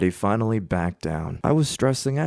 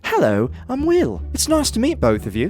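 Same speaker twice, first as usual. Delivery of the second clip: put-on high, louder British accent; fake British accent; 12 semitones higher, 4 dB louder than usual. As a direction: greet them as a cheery fake Brit. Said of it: "I immediately held up my hand".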